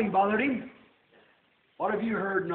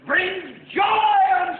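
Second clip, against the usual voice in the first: high, slow, drawn-out sermon shout; fervent preacher at a peak; full-force preaching; 10 semitones higher, 4 dB louder, slower than usual. Real, not acted. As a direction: cry it out to the congregation, stretching the words.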